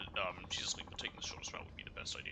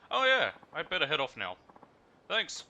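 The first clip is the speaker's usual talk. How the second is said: cheerful passenger voice; 6 semitones higher, 10 dB louder than usual. Acted. Voicing the passenger leaving.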